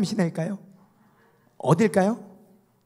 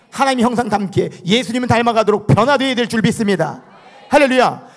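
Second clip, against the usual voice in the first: loud, raised declaration of faith; preacher's ringing blessing and hallelujah; full-force preaching; 6 semitones higher, 8 dB louder than usual. Acted. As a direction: proclaim it to the whole congregation.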